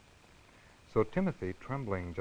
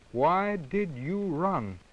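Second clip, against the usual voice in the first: higher, slow and louder demand; angry kitten demanding of mother; big voice; 7 semitones higher, 4 dB louder, much slower than usual. Acted. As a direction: puff up and demand it. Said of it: "he asked in a big voice".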